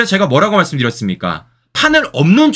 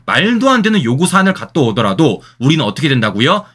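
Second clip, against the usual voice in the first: ordinary delivery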